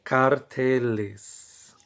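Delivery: carteles said slowly and clearly; slow model word. Shown as a demonstration. Teacher models the plural carteles for students, slowly. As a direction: stretch out carteles syllable by syllable. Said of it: "yo digo".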